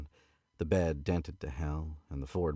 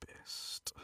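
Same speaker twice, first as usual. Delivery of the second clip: whispering the word to herself; whispered practice; 13 dB quieter than usual. Shown as a demonstration. Reader practising the drawl under her breath, whispered. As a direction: breathe it softly as a rehearsal. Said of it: "whispered rehearsal".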